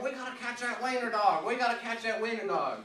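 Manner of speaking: high, urgent mock yell; imitated screaming. Buddy urging everyone after the dog, mock screaming. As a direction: holler it like a frantic buddy, pitched high. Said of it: "he's in the middle of screaming".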